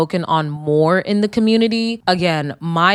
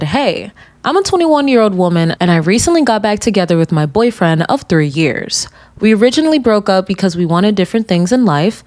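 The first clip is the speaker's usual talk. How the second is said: louder, steady letter-reading voice; reading a letter aloud; 5 dB louder than usual. Acted. Host reading a listener's confession aloud, steady and loud. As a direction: read the listener's letter out clearly.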